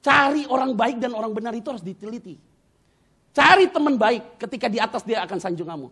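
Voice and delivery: high, loud exhortation easing toward the end; full-force preaching. Acted. Preacher warning the congregation about choosing friends, full-force preaching. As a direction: preach it hard, stretching the openings.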